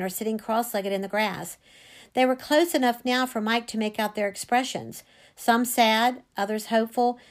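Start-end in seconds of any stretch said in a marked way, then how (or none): none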